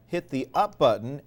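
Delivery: ordinary delivery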